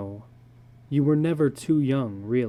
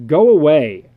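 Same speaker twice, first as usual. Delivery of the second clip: loud command at the lions; sharp loud shout at lions; 12 dB louder than usual. A narrator's reading. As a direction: order them off, full voice.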